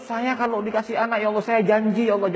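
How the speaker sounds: high, insistent imitation of bargaining in prayer; mock desperate plea. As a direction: plead high, rising in force. Acted desperate prayer promising to God. (menirukan doa memelas)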